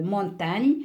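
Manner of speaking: normal talking voice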